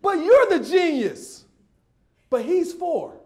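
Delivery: high, incredulous chiding; comic high-voiced protest; mock exasperation. Mocks adults judging child.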